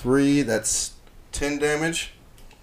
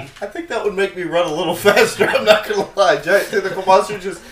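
laughing; 8 semitones higher, 10 dB louder than usual. Real, not acted. Laughing at monster.

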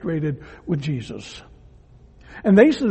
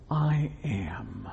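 soft, much lower whisper; whispered answer; 11 semitones lower, 10 dB quieter than usual. Acted. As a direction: breathe it out, hushed. Jesus answers the soldiers.

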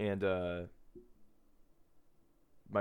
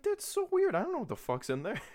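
lightly voiced grandpa.

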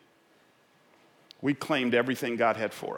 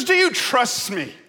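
loud, raised call from above; booming voice from heaven; 12 semitones higher, 9 dB louder than usual. Acted. Voice from heaven questions the man, calling out. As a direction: play God testing a man, loudly. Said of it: "a voice from heaven cried out".

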